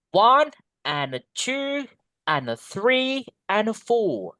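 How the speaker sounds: slow, stretched beats on "One", "two", "three", "four"; sing-song stress beat. Shown as a demonstration. Teacher demonstrating English rhythm to students, sing-song stressed beats. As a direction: chant it slowly, leaning on each number over light "and a". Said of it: "Ada, ada nadanya gitu".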